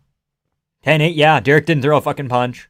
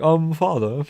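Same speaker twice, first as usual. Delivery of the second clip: put-on British accent, lightly done; a hint of a British son addressing his father; mock British; slower than usual. Son answering his father about the round, mock British accent. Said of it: "I don't know why he becomes British".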